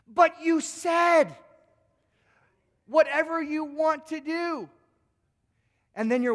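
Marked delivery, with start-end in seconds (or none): 0.1-4.7 loud, slow protest, higher; indignant shout. Acted. Husband protests to his wife, slow and loud. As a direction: shout it out, drawn out and wounded.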